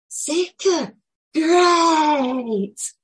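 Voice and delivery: high, loud, joyful cry of great; excited shout. Retold or imitated. The boy shouting back happily, high and loud. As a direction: burst out in delight like the child.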